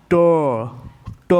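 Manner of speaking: ट with the tongue curled to the middle of the palate; touch the curled tongue tip to the palate for ट; demonstrating ट. Shown as a demonstration. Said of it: "जिह्वा को मोड़कर". (मुड़ी जीभ से ट)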